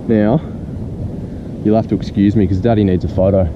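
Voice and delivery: ordinary talk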